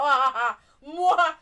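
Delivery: big exaggerated "mwah" kisses; loud mock kiss. Co-host celebrating the match news, loud mock kisses. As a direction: plant loud smacking air kisses. Imitated kissing noises.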